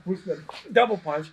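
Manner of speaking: ordinary delivery, nothing marked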